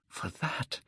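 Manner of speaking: breathless whisper of the wish. A narrator's reading. Dorian whispers his wish to the portrait. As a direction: breathe it, barely voiced.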